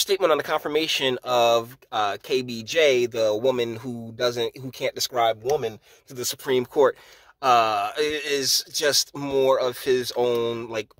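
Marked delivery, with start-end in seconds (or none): none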